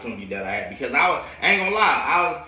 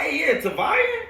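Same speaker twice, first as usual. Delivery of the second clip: high, slow mock teacher calling a name; imitated teacher; 14 semitones higher, slower than usual. Science teacher trying to say his first name, mock puzzled. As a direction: mimic a teacher fumbling a name. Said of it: "he trying to say my first name".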